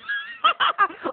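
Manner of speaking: high far cackling laugh; shrieking mom laugh. Retold or imitated. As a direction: laugh at your own joke. Mother laughs.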